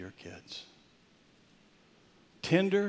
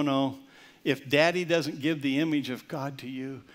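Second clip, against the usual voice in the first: usual speaking voice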